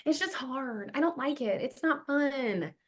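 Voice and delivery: soft, mopey complaining student; a softly mocked lazy student; whiny excuse. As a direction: sulk it out quietly. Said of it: "number one excuse I hear all of the time".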